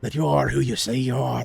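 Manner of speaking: lightly voiced challenger from nowhere; unseen gatekeeper. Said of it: "seemingly nowhere, echoing out, you hear".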